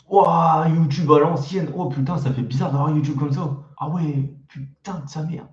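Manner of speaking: genuinely taken aback; amazed. Real, not acted. Amazed by his old YouTube page.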